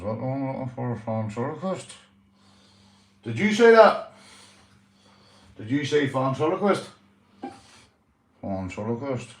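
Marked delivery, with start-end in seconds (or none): 3.2-6.9 high, loud mocking dummy; dummy teasing the owner in a shrill put-on voice; puppet voice. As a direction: puppet jumps in indignant.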